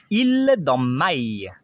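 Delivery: "il dans maï" said slowly, the "il" drawn out like the y in maï; teacher slowly shows the double L sound; sound demo. Shown as a demonstration. Teacher demonstrates the double L sound: slow and careful. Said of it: "il est prononcé comme le".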